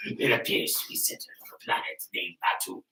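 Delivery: rasping soft villain whisper; quiet rasp, sinister old ruler; Emperor voice. Pretend. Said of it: "you sound like Elmo if you smoke".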